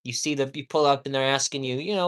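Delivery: normal talking voice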